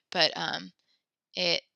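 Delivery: ordinary talk, nothing marked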